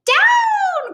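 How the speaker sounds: very high, loud cry of "Down!"; cried out. A narrator's reading. Witch crying out as her bow blows away, high and loud. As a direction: shriek the command to land. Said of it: "cried the witch".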